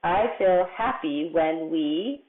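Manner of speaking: word-by-word, drawn-out read; slow reading aloud. Shown as a demonstration. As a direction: read it back slowly for young writers. Rereading the sentence word by word.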